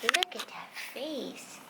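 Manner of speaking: slow soft cooing to a pet. Real, not acted. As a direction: coo at the dog. Talking to her dog.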